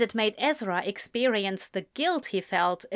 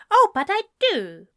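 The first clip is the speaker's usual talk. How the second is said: defiant; 11 semitones higher, 7 dB louder, faster than usual. A narrator's reading. Ezra defiant.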